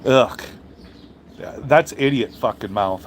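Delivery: ordinary delivery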